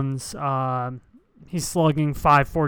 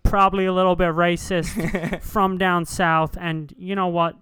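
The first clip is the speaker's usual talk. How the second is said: louder, laughing Boston homer voice; Boston broadcaster; 2 semitones higher, 3 dB louder than usual. Pretend. The Boston announcer gushing about Pedroia, mild Boston voice. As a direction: praise the local hero like a Boston announcer. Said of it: "you be him".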